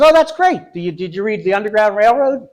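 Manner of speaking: high, loud, bright-eyed enthusiasm; delighted high question. Retold or imitated. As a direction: gush over his book choice. Librarian excitedly asks.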